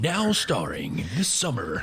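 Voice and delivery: slow booming trailer announcer; drawn-out epic trailer read; movie trailer voice. Pretend. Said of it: "that movie voice".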